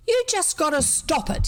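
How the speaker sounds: high, insistent scolding; fierce. A narrator's reading. Boy ordering a supposed murderer to stop, fierce. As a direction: lay down the law, pitched right up. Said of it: "he said fiercely".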